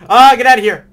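high panicked yell; scared yell. Real, not acted. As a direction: yell at the monster in fright. Yelling at jumpscare monster.

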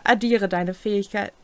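ordinary talk, nothing marked

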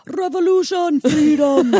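loud, high Scottish battle cry; rousing mock-Scottish yell; Scottish accent. Shown as a demonstration. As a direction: shout it like a hammed-up Scottish rebel. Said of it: "in a Scottish accent?".

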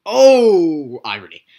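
mock exclaim. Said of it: "exaggerated irony cry".

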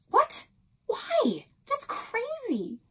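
high, loud cry of disbelief, slowing on the last words; stunned protest at the news; shocked disbelief. Acted. Girl shocked shop is closing.

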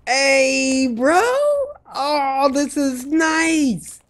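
high, drawn-out admiration; high-pitched happy marvelling; delighted exclamation. Real, not acted. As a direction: stretch it out, thrilled with the find. Delighted by finished treehouse.

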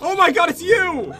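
high, loud burst of recognition; surprised shout. Real, not acted. Player recognises the attacker, surprised shout. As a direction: shout it as you spot them.

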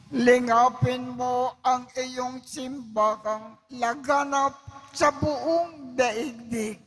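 ordinary talk, nothing marked

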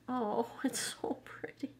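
a hushed, swooning whisper; breathless whisper. Real, not acted. Vlogger swooning over a pretty highlighter, whispered. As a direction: whisper it, overwhelmed.

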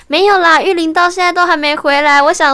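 imitating the boss fretting she ran off; worried boss. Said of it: "然后陶哥就说".

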